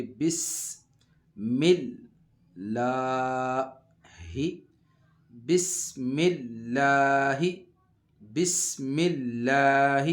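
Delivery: drawn-out Quranic recitation, sibilant s whistling; recited Bismillah, whistled s. Shown as a demonstration. Teacher showing pupils the whistling s, slow recitation. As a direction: stretch بسم اللہ slowly with a whistling س. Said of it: "سین میں سیٹی کی آواز آئے گی۔".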